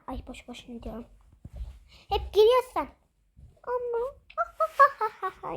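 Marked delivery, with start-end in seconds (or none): 3.6-5.6 laughing. Acted. Dolls can't hear the girl.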